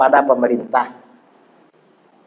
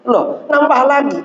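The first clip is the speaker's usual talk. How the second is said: high-pitched mock astonishment; comically taken aback; surprised questioning; 10 semitones higher than usual. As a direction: retell it as an incredulous question, pitch jumping up. Retold surprise at another new wife.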